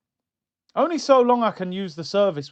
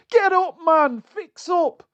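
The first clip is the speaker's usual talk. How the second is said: higher, louder mock shout of get up; 10 semitones higher, 4 dB louder, slower than usual. Fan yells at the limping player, louder and higher. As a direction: bark it at him like a teammate.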